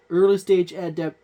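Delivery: usual speaking voice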